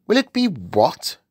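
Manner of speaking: high-pitched regal query; baffled Queen. Acted. Queen queries the giant's word, baffled. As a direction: query it in disbelief.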